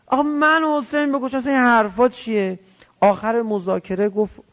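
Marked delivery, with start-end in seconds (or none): 0.1-2.6 high-pitched, acted-out refusal; indignant protest. Omar Sa'd balks at killing Hossein, indignant. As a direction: retell an outraged objection in a raised pitch.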